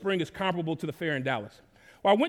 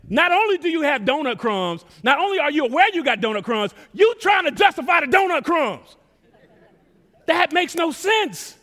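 high, raised preaching voice; incredulous outburst; 7 semitones higher, 5 dB louder than usual. Real, not acted. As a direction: build to an incredulous high peak. Preacher mocks justifying donut crumbs.

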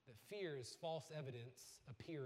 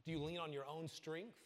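usual speaking voice

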